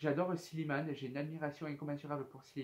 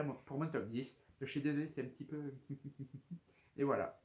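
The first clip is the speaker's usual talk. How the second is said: normal talking voice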